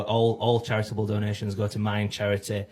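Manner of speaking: ordinary talk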